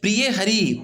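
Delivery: high-pitched call from outside the door. Acted. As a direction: call out to be let in. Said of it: "आवाज़ लगाते हैं".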